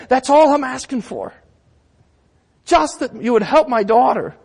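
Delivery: high, loud, insistent plea; pleading woman. Acted. Canaanite woman begging Jesus for her daughter, impassioned plea. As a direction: plead boldly, pitched well up. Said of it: "And she says".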